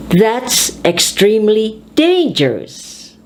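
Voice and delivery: spaced words, peak on "dangerous"; stress on the adjective. Shown as a demonstration. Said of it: "you stress on the adjective".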